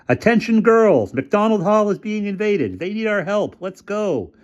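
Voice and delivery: high, slow rallying call; rally the girls over the speakers; PA announcement. A narrator's reading. Kathy calling the girls over the PA, high and urgent. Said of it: "P A system burst into life".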